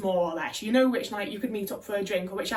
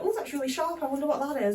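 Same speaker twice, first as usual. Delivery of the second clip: mock surprise; 4 semitones higher, faster than usual. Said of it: "I'll be like".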